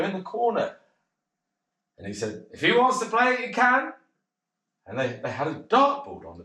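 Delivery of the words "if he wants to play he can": lightly voiced barman, higher; a hint of a grudging barman. Barman lets the lad play, lightly voiced higher.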